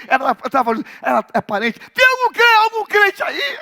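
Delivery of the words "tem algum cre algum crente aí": high, loud call for a believer. Retold or imitated. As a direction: shout it to the whole crowd. Woman calling out. (grito chamando um crente)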